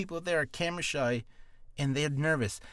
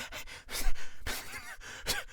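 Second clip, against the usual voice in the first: laugh; 3 dB quieter than usual. Real, not acted. Nervous retake laugh.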